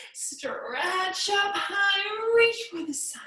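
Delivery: slow, high-pitched stretched-out cue; stretching up high. Pretend. Yoga teacher cueing kids to reach up, high drawn-out call. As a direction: let the voice climb with the stretch.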